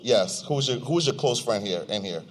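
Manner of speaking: ordinary talk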